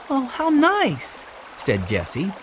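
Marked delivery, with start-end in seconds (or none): 0.1-1.2 high, slow, drawn-out delight; high girlish exclamation; pleased girl. A narrator's reading. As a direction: savour the good news. Jessie pleased by news.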